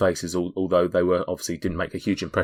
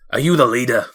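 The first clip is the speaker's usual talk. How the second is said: a hint of a dwarf, raised pitch; louder dwarf voice, lightly put on; lightly accented dwarf; 4 semitones higher, 6 dB louder than usual. Dwarf asks the party who leads, in character. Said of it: "the dwarf who spoke to you".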